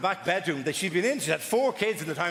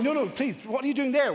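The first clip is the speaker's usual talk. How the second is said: high pleading plea; pleading presenter; 7 semitones higher than usual. Retold or imitated. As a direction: beg them to stop, higher. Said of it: "she's going".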